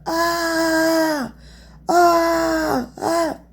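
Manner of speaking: sustained high vocal "aah", repeated; high held "ah". Shown as a demonstration. Showing how she tried to make sound come out, high held "aah". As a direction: push out a long high "ah". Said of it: "how I would try to".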